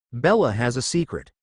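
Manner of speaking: plain reading voice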